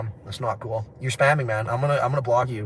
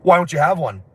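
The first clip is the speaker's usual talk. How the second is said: aggressive question; 5 semitones higher, 6 dB louder than usual. Retold or imitated. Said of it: "really aggressive way".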